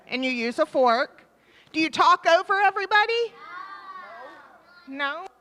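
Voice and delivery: ordinary delivery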